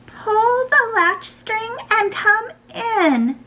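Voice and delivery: very high, slow granny voice; grandmother. A narrator's reading. Grandma lets her granddaughter in, high and drawn-out. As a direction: call out slowly as a high-voiced old granny. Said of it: "replied Grandma".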